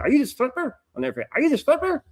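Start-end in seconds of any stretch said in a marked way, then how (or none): 0.0-0.8 put-on a light Irish accent, pitched higher, asking a question; imitated Irish accent. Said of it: "her accent". 1.3-2.0 put-on a light Irish accent, pitched higher, asking a question; imitated Irish accent. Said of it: "her accent".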